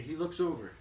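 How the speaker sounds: plain reading voice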